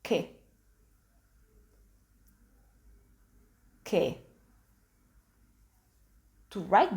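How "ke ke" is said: Ke as hard k plus open E, "keh"; Italian sound demo. Shown as a demonstration. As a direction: say Ke as one isolated syllable. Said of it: "The second sound is".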